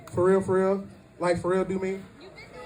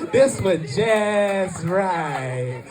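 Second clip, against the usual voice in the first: extremely slow, savoured words; Goldilocks parody; much slower than usual. Acted. Comic repeats the Goldilocks line, very drawn-out. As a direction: draw every word way out. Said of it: "Just three little bears and Goldilocks".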